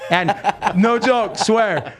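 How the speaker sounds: can't hold back a laugh while swearing it's true. Real, not acted. Laughing as he tells the host about the fiddle player.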